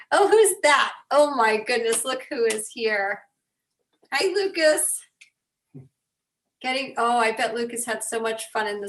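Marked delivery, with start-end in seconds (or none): 0.1-3.2 delighted surprise. Real, not acted. Delighted greeting to child Lucas appearing. 4.1-4.9 high-pitched hello to a little one; sing-song, doting hello; affectionate greeting. Real, not acted. Greeting child Lucas on the call.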